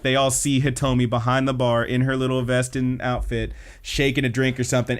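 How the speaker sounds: ordinary delivery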